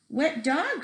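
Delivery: a mild cry of annoyance; cried out. A narrator's reading. The man with the shiny car crying out at the dog. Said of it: "cried the man".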